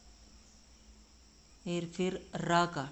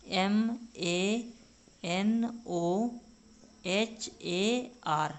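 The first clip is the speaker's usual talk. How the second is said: every letter slow and separate; spelling out slowly; much slower than usual. Shown as a demonstration. Teacher spells Manohar slowly for students to copy. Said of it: "सबको मिला देते हैं".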